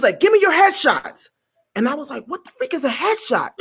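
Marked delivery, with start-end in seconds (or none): 0.2-1.3 high-pitched brusque order; bossy demand. Retold or imitated. Producer demanding photos from a newcomer, bossy. 2.3-3.5 higher, softer bewildered aside; puzzled to yourself, acted out; baffled. Newcomer clueless about the producer's demand, baffled.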